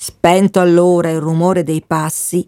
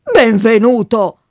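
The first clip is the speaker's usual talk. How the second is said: a firmly raised call of the name; colonel's call; 3 semitones higher, 4 dB louder than usual. A narrator's reading. Colonel calls to the boy, mildly raised shout. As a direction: call the boy's name with some force. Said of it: "gridò allora il colonnello con impeto terribile".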